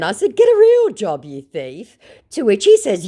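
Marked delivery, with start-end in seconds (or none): 0.4-2.0 mocking jab. Her taunt to scammer.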